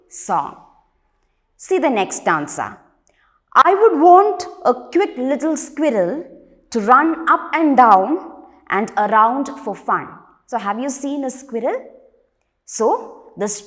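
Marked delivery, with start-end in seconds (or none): 3.5-10.1 loud, lifted verse reading; poem recitation. Acted. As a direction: project the stanza to a class. Rereads the stanza aloud.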